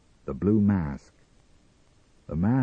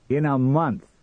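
loud, higher-pitched retort; 7 semitones higher, 5 dB louder than usual. Acted. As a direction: answer loudly and firmly. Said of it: "he said loudly".

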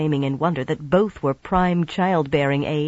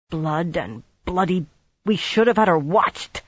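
growled through teeth. A narrator's reading. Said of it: "Swan growled through her teeth".

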